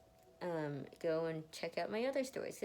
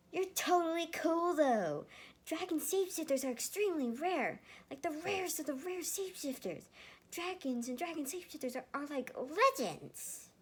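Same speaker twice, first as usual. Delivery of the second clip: put-on higher, louder excited kid voice; excited boy; 11 semitones higher, 5 dB louder than usual. Young Emil, meeting a dragon shapeshifter, higher and louder excited gushing. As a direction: gush about dragons like a thrilled child.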